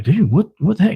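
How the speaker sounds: startled retelling. Retold or imitated. Owner retelling his scare at the attack, startled. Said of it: "that scared me".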